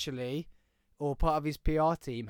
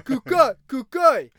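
excited shout; 8 semitones higher, 11 dB louder, slower than usual. Real, not acted. Excited calling of fighter's name.

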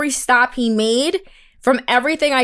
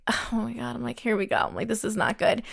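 muttered dread; 11 dB quieter than usual. Retold or imitated. Retold own dread, muttered.

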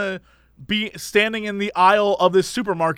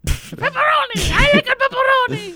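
very high nerdy goblin; goblin kid voice. Retold or imitated. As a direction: squeal it like a cartoon goblin child. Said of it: "nerdy kid's voice is like a two thousand four goblin".

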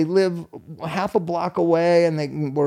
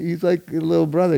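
usual speaking voice

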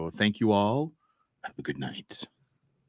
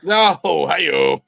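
loud, higher joke reaction; gleeful exclamation; 6 semitones higher, 8 dB louder than usual. Real, not acted. Host hoots at guest's mother-in-law answer, gleeful exclamation. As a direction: whoop it out like a punchline sting.

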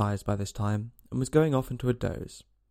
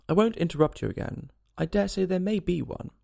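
higher-pitched, meek promise; humble; 6 semitones higher than usual. A narrator's reading. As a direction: promise humbly, anxious to appease. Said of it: "Alice said very humbly".

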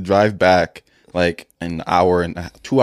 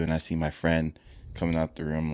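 ordinary talk

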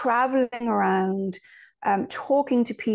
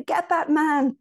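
a slow, higher mock grandmother telling someone off; imitating grandma; 6 semitones higher, slower than usual. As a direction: do granny scolding the telly, drawn out. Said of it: "my grandma would say".

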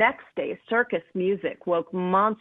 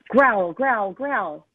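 first growl louder, the rest fading; growling sounds; 4 dB louder, much slower than usual. Acted. Narrator voicing the monster's growls at the popcorn smell, a touch loud.

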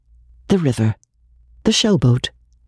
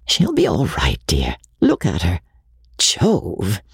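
lower, steady reassuring man; calm husband; 5 semitones lower than usual. Acted. Husband reassuring his tearful wife in the car, lower and calm. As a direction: soothe her gently, a man's voice. Ken comforting Kim, lower voice.

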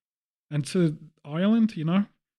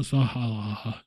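lower, exaggerated dude drawl; Valley guy voice; 6 semitones lower, slower than usual. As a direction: push the dude voice further. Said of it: "Let's get a bit too far".

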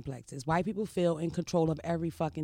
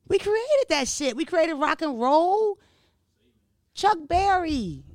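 proud boast; 9 semitones higher, 5 dB louder, slower than usual. Real, not acted.

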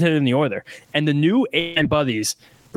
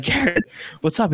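excited greeting; 7 semitones higher than usual. Retold or imitated. Greeting a star in person.

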